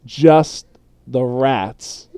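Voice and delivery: slow, deliberate threat; slow menace. Acted. Moira threatens David with slow, drawn-out menace. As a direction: drag it out to scare him. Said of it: "she says".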